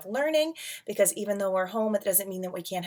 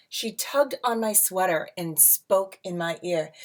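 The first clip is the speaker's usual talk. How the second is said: lilting read-aloud storybook voice; rhyming picture book read to a class; reading to children; 3 semitones higher, slower than usual. A narrator's reading.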